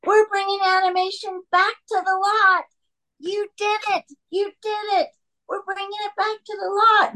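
high, excited mock-exclamation; jubilant. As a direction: exult like a thrilled studio boss swinging someone round. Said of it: "spins me around and says".

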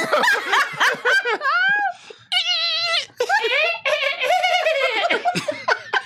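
long high evil cackle; maniacal laugh. Pretend. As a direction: cackle like a villain. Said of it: "That's a maniacal laugh".

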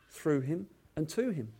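ordinary talk, nothing marked

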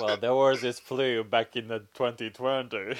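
storyteller voice. Pretend. Mock podcast intro, loud.

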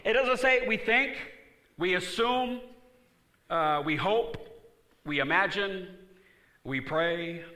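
high, loud, building preaching; loud sermon climax; full-force preaching. Acted. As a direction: drive it home with rising fire.

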